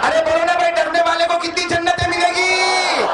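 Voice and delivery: high, ringing call to the crowd; full-force preaching. Acted. (जोशीली तक़रीर, ऊँची आवाज़ में)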